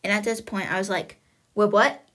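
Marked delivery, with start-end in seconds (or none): 1.5-2.0 sudden loud "Wait, what?" in disbelief; shocked. Retold or imitated. Listener blurting out her shock at the news, loud and startled. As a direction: blurt it out, caught off guard.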